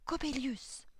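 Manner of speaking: high, loud, drawn-out gasp of alarm; panicked cry. A narrator's reading. Girl hears the old clockmaker coming, panicked cry. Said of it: "Affolée".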